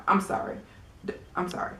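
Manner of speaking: breathy, unvoiced sorry, barely there; sheepish whisper to the viewers; whispered apology. Real, not acted. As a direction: whisper it like a guilty confession.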